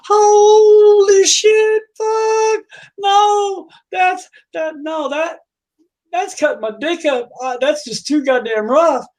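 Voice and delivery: very high howl, easing into high protest; pained outcry. Acted. Customer hurt in bed, howling and protesting. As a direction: open with a long, loud wail of pain. Customer in sudden pain, acted.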